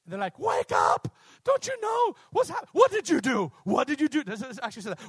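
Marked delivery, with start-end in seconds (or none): 0.4-2.7 high, loud wake-up shout; alarmed sailors shouting. Acted. Sailors waking Jonah in the storm. 2.7-4.2 accusing sailors. Acted.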